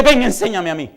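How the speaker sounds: loud, high impassioned preaching; fervent sermon climax; preaching at full force. Acted.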